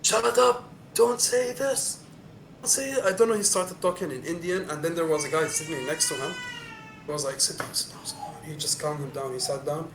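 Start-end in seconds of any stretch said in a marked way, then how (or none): 0.0-3.0 angry stranger. Retold or imitated. Angry man hushes him. 7.4-8.4 soft unvoiced sit down; calm him down quietly; hushed calming. Friend calming the angry man, hushed calming.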